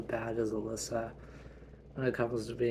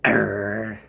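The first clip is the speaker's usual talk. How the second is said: a big playful angry growl; 2 semitones lower, 10 dB louder than usual. Acted. Mother held back by dad, growling loud.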